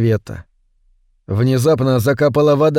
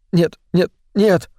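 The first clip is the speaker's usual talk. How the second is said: higher-pitched, tearful denial; sobbing refusal; 5 semitones higher than usual. A narrator's reading. Man sobbing in Бет's hands, tearful refusal. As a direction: repeat it helplessly, as if sobbing. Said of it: "всхлипывал он".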